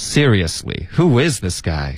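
Seriously joined up but slow, IS punched; measured model with weight on is; stress on IS. Shown as a demonstration. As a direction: lift is in who is, then fall. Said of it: "在is上加重音".

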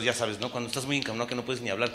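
usual speaking voice

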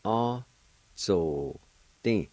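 "Ah, so, ding" as separate syllables; careful teacher's breakdown of "Ah, so, ding"; syllable-by-syllable pronunciation. Shown as a demonstration. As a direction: sound out "Ah, so, ding" with a pause after each. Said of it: "First part is pronounced like this".

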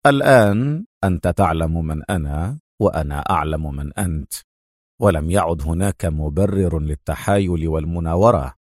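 cool, low-pitched composure; cold. A narrator's reading. Adham answering his angry rival, cold. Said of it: "أجابه أدهم في برود".

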